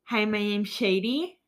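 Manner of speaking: slow, lisping self-introduction, "Shady" slurred with a sh sound; imitated lisp. Speaker imitates their eighth-grade self with a retainer, lisping. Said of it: "I had a lisp for about a year".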